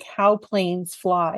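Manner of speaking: very slow, deliberate; slow reading. Retold or imitated. Reading the daughter's T-shirt aloud, very slowly. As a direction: read each word out slowly. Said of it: "a T-shirt that's like".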